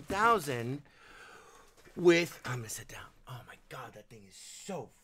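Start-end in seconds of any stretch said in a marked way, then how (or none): 2.4-4.9 hushed, breathless whisper. Real, not acted. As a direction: whisper it under your breath. Whispered aside about heavy thing.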